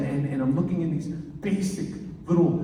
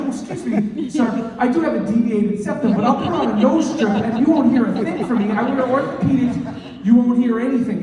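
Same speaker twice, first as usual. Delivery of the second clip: higher, louder, huffy self-defense; mock indignation; 6 semitones higher, 5 dB louder than usual. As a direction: protest your flaws with wounded pride. Defending himself to the general.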